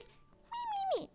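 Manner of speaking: tiny, high, soft whine; mock baby-voiced imitation; mocking an accuser. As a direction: squeak it mockingly, small and high. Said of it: "this person is just like".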